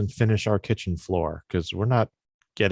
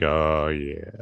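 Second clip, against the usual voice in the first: low morning voice; 7 semitones lower, 5 dB quieter than usual. Retold or imitated. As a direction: drop into a sultry bass. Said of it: "Wake up, you're like".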